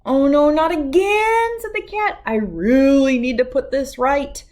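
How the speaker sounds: loud, high panicky cat voice; panicked cat. A narrator's reading. Cat despairing as the witch becomes a dog, loud and high. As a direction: cry out in dismay.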